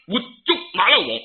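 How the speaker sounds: usual speaking voice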